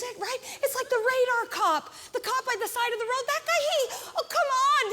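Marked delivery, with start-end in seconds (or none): 3.3-4.9 higher, laughing scoff; mocking scoff. Real, not acted. Comedian laughing off the radar cop in a high scoff. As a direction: scoff at him, come on.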